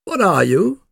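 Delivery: loud, high-pitched puppy demand; loud question. A narrator's reading. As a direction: ask it loudly, voice pitched high. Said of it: "said the Old English Sheepdog puppy loudly".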